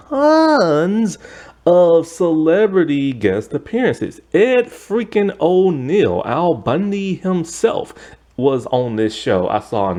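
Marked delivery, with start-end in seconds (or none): 0.1-7.9 excited. Real, not acted. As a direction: pitch up in excitement. Excited listing of guest stars.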